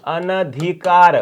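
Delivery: अनधिकार drawn out slowly, short अ after अन; slow correct form. Shown as a demonstration. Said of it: "और शब्द बनेगा हमारा क्या?". (अनधिकार को धीरे और साफ़ बोलना)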